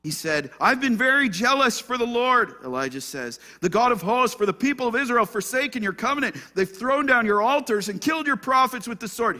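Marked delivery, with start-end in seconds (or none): 0.6-2.5 high, loud lament; loud discouragement. Acted. Elijah complains to God in the cave, discouraged outcry. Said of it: "Hear the discouragement?". 3.6-9.4 high, loud lament; loud discouragement. Acted. Elijah complains to God in the cave, discouraged outcry. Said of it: "Hear the discouragement?".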